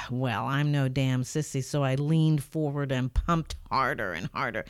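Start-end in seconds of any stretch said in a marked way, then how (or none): none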